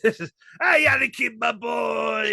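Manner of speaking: high, hammed-up London Watto voice; squeaky comic Cockney alien trader; London accent Watto. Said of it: "Watu with a London accent".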